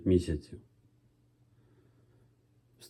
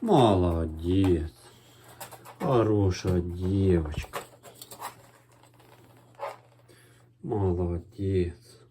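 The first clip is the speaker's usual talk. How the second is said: slow gentle praise; praising a dog; slower than usual. Real, not acted.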